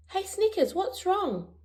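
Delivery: put-on high, bright-eyed young pup voice; Bluey's voice. A narrator's reading. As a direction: lift into a little kid's voice, concerned. Narrator voicing Bluey in story.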